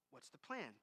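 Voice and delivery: low, soft answer; calm reply. Retold or imitated. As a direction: answer quietly, steadily. Objector versus God's answer.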